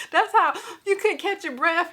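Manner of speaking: words spilling through laughter; high laughing voice; laughing while talking. Real, not acted. Laughing while describing crying.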